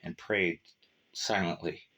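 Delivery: ordinary delivery, nothing marked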